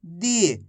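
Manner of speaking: दी held long, falling at the end; drawn-out long ī. Shown as a demonstration. Teacher modelling दा plus matra for class, drawn out. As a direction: stretch the ī in दी. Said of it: "खींच खींच के इसका उच्चारण करना है".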